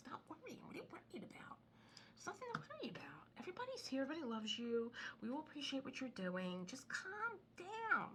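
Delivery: high, breathy mock reassurance; a hammed-up calming of a worried husband; mock soothing. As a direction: coo at him to calm down.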